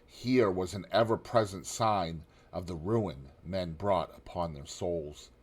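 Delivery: usual speaking voice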